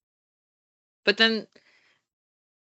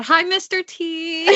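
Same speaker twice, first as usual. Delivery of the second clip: excited greeting; 8 semitones higher, slower than usual. Real, not acted. Greeting a former teacher.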